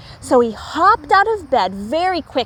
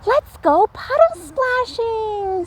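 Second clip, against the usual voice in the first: eager squirrel; 10 semitones higher, slower than usual. A narrator's reading. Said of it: "said Red Squirrel".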